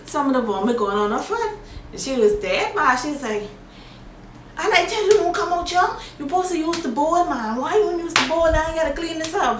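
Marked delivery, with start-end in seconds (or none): 4.6-9.6 mad nurse. Retold or imitated. Nurse scolding her for vomiting on her foot, higher mock anger. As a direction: imitate the fed-up nurse chewing her out. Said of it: "So she was so mad".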